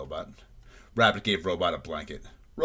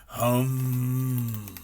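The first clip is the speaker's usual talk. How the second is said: higher, long humming snore; snoring; 5 semitones higher than usual. A narrator's reading. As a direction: snore as a sustained robotic hum. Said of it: "snored Robot".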